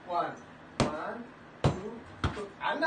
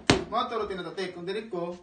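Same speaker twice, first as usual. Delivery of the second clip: normal talking voice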